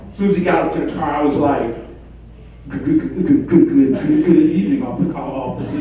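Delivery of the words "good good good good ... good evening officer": stuttered, stuck on the first word; stammering greeting. Acted. Driver greeting officer at traffic stop, stammering. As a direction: trip repeatedly on the opening word, then get it out.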